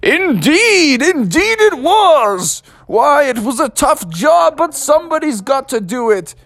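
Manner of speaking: put-on high, slow stately old statesman; slow theatrical Lincoln voice; Abraham Lincoln. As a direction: pronounce it grandly like an old president. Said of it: "Abraham Lincoln said".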